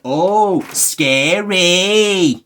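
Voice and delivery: high, loud mock fright; deadpan fake scare; sarcastic. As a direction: say scary as if totally unimpressed. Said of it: "not being serious".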